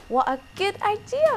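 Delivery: high, loud and eager; high fox voice. Acted. Fox number three agreeing with his brothers, high and loud. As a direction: squeal it out like a little fox. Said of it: "replied fox number three".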